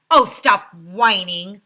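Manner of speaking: mayor waving off a whiner; dismissive. A narrator's reading. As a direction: brush off the complaint. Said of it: "said Mayor Hubble".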